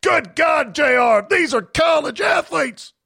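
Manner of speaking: high, loud screamed announcer call; full-throated wrestling announcer shout. Acted. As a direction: scream it like J R losing it. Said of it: "'cause I'm screaming again".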